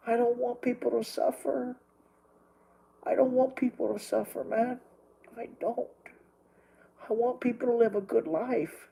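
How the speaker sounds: real anguish, held back; distressed plea. Real, not acted. Distressed plea against people suffering.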